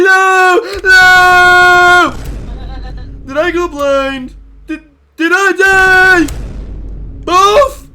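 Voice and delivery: loud, high mock terror; hammed-up panic. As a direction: wail like you've gone blind.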